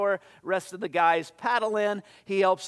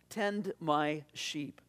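soft and very slow; slow it right down, gently dropping the volume; hushed charge; 3 semitones lower, 6 dB quieter, much slower than usual. A narrator's reading. Jesus charging Peter a second time, softly and slowly.

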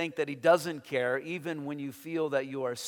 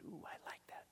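whispered, unvoiced aside; 22 dB quieter, faster than usual. Real, not acted. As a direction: drop to a secret whisper. Whispered aside retelling reaction.

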